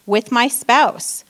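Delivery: ordinary talk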